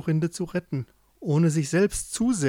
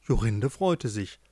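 plain reading voice

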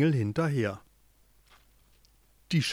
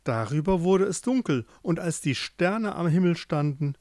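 usual speaking voice

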